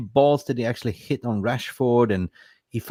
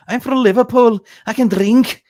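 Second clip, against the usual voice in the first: much higher, louder mocking drunk boast; mock drunk Scouser; 12 semitones higher, 9 dB louder than usual. Mocking drunk Liverpool women in Dubai, high loud boast. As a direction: lampoon a drunk Liverpool girl bragging.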